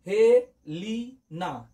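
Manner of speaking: each syllable spaced far apart; very slow. Shown as a demonstration. Teacher dictating slowly so students can write. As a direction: stretch out every syllable. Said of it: "আমি আস্তে আস্তে বলছি".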